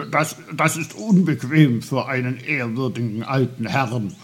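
put-on fast, loud, flustered old gentleman; indignant stutter. A narrator's reading. Old uncle complains of being held uncomfortably, indignant. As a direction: protest with offended dignity, stumbling at the start. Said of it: "stotterte Onkel Pfefferkorn entrüstet".

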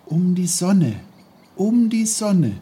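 put-on high, loud boy's musing; Peter, musing child. Boy thinks to himself about the sun, musing intently. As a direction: repeat it high, caught up in the thought.

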